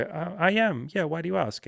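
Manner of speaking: lightly voiced curious woman; woman replying. Voices the woman being asked.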